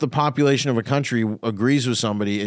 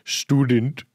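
mock immigrant voice; 2 semitones higher than usual. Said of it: "They just go".

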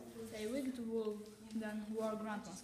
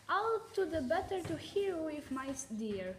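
louder, sly big bad wolf; 6 semitones higher, 5 dB louder than usual. A narrator's reading. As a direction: purr it like a tricky wolf. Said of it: "said the wolf".

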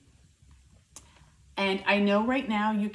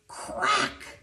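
crack of the bat; 8 semitones higher, 4 dB quieter than usual. A narrator's reading. Bat hits ball.

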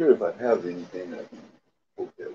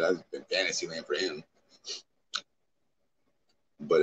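usual speaking voice

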